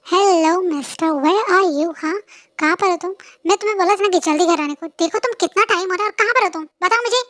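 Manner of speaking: loud, fast scolding over the phone, rising in pitch at the end; angry scolding. Acted. Jimin scolds Jungkook on the phone for being late, loud angry scolding. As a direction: chew him out for not coming home.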